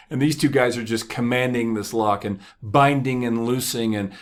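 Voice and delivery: normal talking voice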